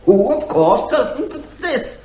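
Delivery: laughing through the punchline; dry laugh at his own joke; amused laugh. Acted. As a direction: chuckle as you finish the point. Witness mocks the average person.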